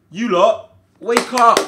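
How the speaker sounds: loud, high telling-off; frustrated bark; scolding shout. Real, not acted. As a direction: yell at the players to wake up. Scolding his team.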